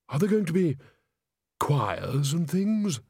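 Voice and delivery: high, lightly voiced ghost; chatty, curious ghost. Ghost asks eagerly.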